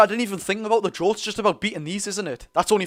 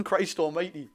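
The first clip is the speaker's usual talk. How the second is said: usual speaking voice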